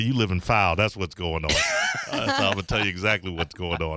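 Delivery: brisk radio host voice; radio host read. Acted. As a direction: read the call-in line like an announcer.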